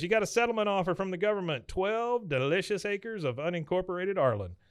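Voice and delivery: high-pitched, hammed-up pitch of good news; excited announcement. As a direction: present it like a grand prize. Dale announces the offer, acted out.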